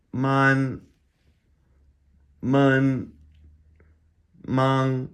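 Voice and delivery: every syllable from Ba to mang high, loud and level; first tone drill. Shown as a demonstration. Teacher ripping through syllables in first tone, high flat. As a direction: hold each syllable flat on a high pitch. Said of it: "rip through these in the first tone".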